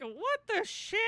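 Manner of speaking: high-pitched retold astonishment; shocked disbelief. Podcaster recalling the plot twist, shocked. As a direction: replay a baffled outburst. Retelling own startled reaction.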